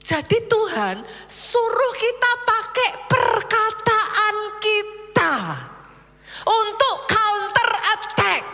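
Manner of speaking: high, slow, driving sermon voice; fiery preaching. Acted. Pastor rousing his congregation, slow and high. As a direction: preach it, stretching every word.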